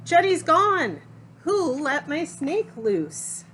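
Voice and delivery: high, loud cry of alarm; alarmed shout. Acted. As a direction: shout it out to the whole class. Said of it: "Emily shouts".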